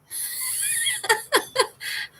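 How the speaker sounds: high-pitched laugh; giggling; high giggle. Real, not acted. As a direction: squeal a giggle. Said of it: "laughing at herself".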